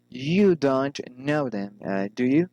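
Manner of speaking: "them" falls, "do you" rises; falling then rising. Shown as a demonstration. Teacher models tag questions, falling then rising on "do you". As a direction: drop on "them", lift "do you". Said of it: "falling or rising intonation".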